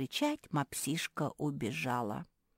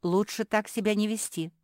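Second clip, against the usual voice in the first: higher, louder admonition; curt rebuke; 6 semitones higher, 6 dB louder than usual. A narrator's reading. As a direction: cut the idea off firmly. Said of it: "отрезала Капитолина".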